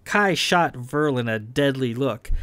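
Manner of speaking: ordinary delivery, nothing marked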